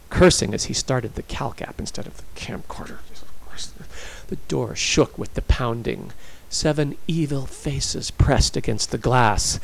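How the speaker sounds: plain reading voice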